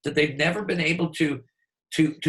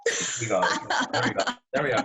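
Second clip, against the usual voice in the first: ordinary talk, nothing marked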